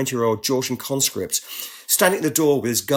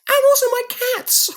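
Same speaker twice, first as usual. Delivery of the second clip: mock squeak; 5 dB louder than usual. Own mock reply, squeaky.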